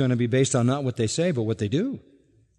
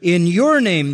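"your" punched higher and louder, falling; stress on YOUR; 3 semitones higher, 5 dB louder than usual. Shown as a demonstration. Preacher showing the congregation the emphatic "your", stressed. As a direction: hit the word "your" in "In your name". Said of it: "the you is emphatic each time".

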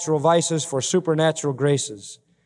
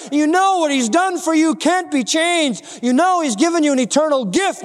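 preach; 11 semitones higher, 4 dB louder than usual. Real, not acted. Preacher assures believers of an eternal gift, forceful preaching. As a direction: preach it out in a raised voice.

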